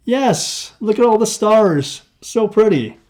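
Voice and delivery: high-pitched, wowed reply; delighted admiration. A narrator's reading. Narrated dialogue, admiring the stars.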